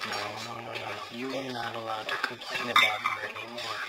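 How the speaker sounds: low, soft refusal; hushed objection. Acted. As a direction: object quietly, keeping it low. Partner quietly forbids further complaints.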